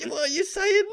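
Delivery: squeaky high mock glitching voice; twitchy mock robot. Mocking a friend's twitchy reaction to the AI talk, squeaky high. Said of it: "twitching and going".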